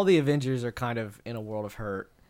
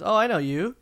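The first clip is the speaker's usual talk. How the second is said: surprised; 8 semitones higher, 4 dB louder than usual. Retold or imitated. Retold Hawkeye quote.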